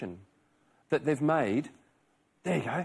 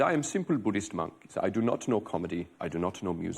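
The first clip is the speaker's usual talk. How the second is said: put-on lower, softer Tibetan-accented voice; a lightly done Dalai Lama accent; Dalai Lama impression; 5 semitones lower, 4 dB quieter than usual. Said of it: "Why are you talking like that?".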